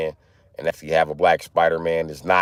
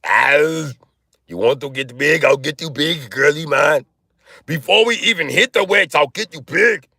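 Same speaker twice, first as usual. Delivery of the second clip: Arnold; 10 semitones higher than usual. Acted. Trainer bragging he will get a girly man big, hammed-up Arnold impression. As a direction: push it out like a pumped-up muscle-man trainer. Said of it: "On that Arnold shit".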